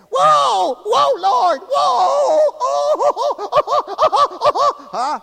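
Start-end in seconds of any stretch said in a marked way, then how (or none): 0.1-4.8 loud, high hammed-up wail breaking into whoops; mock wailing scream. Preacher mimics people who come in to pray, mock wailing. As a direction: wail it out in mocking imitation of church criers. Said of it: "they wail and they scream".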